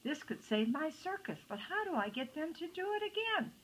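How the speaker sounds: high, fretful self-questioning; worried talking to oneself; agitated muttering. A narrator's reading. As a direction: puzzle aloud, pitch climbing. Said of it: "muttering to himself".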